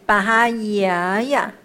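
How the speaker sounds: 巴哈呀呀 slowly, a long 呀 turning into a short one; turning sound. Shown as a demonstration. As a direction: hold the first 呀, then release the last. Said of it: "就转音了呀".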